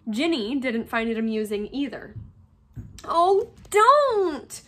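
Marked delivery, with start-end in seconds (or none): 3.0-4.6 high, loud wail; wailing protest. A narrator's reading. Ginny not finding the teasing amusing, wailing. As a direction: wail it out, pleading. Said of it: "she wailed every time".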